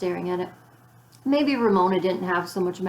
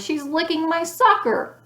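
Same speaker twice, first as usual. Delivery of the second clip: put-on much higher, slow tattling boy's voice; loud outraged boy; 10 semitones higher, 4 dB louder than usual. A narrator's reading. Wayne tattling on Ramona to the class, loud outrage. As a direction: wail it like an indignant little boy. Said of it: "interrupted Wayne in a loud voice".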